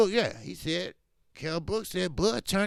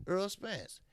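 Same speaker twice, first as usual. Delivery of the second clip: usual speaking voice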